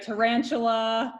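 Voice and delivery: tarantula said like the spider, ta-RAN-chu-la; name mangled into tarantula; mispronounced name. Retold or imitated. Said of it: "Not everybody can do that".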